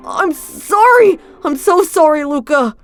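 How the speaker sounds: high, loud, slow broken sorry; pleading apology. Acted. Felix apologizes to Luca, high and pleading. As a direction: beg forgiveness near tears. Tearful apology after rejection.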